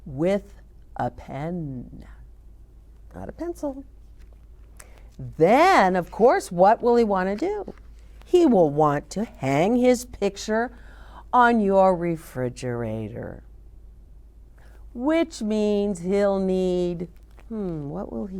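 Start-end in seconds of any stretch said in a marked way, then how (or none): none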